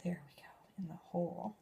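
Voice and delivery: whispered while concentrating; concentrated little whisper to herself. Real, not acted. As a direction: breathe the words almost silently. Concentrating on placing piece.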